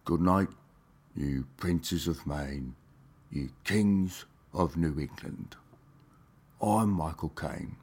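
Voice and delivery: half-hearted Michael Caine; Michael Caine impression. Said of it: "Now I'm going to do Michael Caine".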